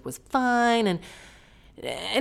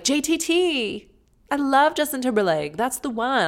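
mock fangirl; 8 semitones higher than usual. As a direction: gush like a starstruck teen. Mocking a fangirl her age.

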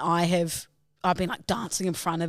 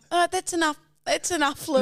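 much higher mock mum voice; mum scolding; 9 semitones higher than usual. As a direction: tell the child off. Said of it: "Mum's going".

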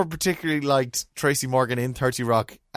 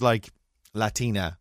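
ordinary talk

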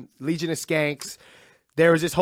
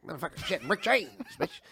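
softly rehearsed catchphrase voice; Chappelle warm-up; 8 dB quieter than usual. Retold or imitated. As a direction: practise the impression quietly. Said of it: "I'm like".

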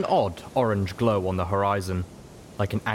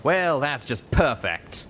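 grousing; 6 semitones higher, slower than usual. A narrator's reading. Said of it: "Calamity groused".

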